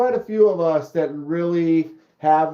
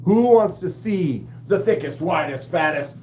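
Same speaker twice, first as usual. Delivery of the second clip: hype announcer. Pretend.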